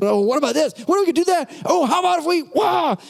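high, breathless-sounding rush of new ideas; squeaky-high mock enthusiasm, ending in a wild "wah"; hyperactive idea-spouting. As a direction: mimic an overexcited friend bouncing between ideas. Acting out hyperactive friend's ideas.

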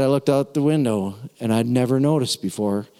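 ordinary talk, nothing marked

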